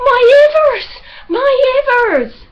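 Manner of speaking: high, loud shrieking cry of alarm; panicked shout. A narrator's reading. Prudy shrieking in fright as the tree falls, high and loud. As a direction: wail it in fright.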